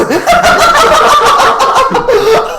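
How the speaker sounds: loud squealing laugh; helpless high laugh at the story; big high laugh. Real, not acted.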